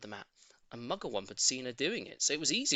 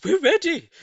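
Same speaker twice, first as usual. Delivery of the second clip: much higher, louder bird chorus; birds answering; 14 dB louder than usual. A narrator's reading. Birds answering Mugglewump, high loud chirpy reply. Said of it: "said the birds".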